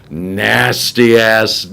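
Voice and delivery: plain reading voice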